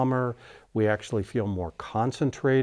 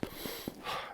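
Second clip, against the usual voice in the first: audible shallow chest-breathing sigh; demonstrated chest breath; 16 dB quieter than usual. Shown as a demonstration. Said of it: "and we breathe like that".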